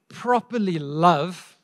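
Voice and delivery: properly drawn out slowly and leaned on; stress on properly. Shown as a demonstration. Preacher to congregation, stressing properly slowly. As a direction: stretch out properly, properly love. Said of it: "stresses properly, slowed".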